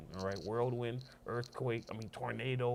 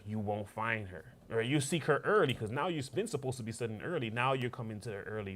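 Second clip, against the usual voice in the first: ordinary delivery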